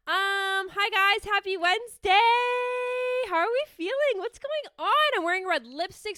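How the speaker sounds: high, loud, bubbly greeting; excited. Real, not acted. Podcaster wishing listeners happy Wednesday, excited. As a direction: greet everyone high with stretched endings. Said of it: "sorry, I'm excited. I need to calm down".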